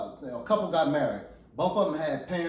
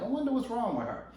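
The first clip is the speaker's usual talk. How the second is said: puzzled husband; 6 semitones higher, 4 dB quieter than usual. Acted. Husband brooding all day at work.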